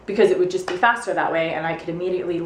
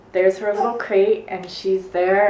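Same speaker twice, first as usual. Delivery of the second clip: ordinary talk, nothing marked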